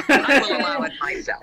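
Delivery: laughing through a mock decree; giggling while playing king.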